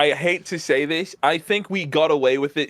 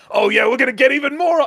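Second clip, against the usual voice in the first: excited comedian; 9 semitones higher, 5 dB louder, faster than usual. Retold or imitated. Comedians piling on, acted quote.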